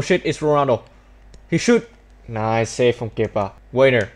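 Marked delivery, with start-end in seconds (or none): none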